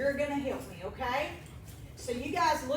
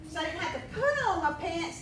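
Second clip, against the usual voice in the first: usual speaking voice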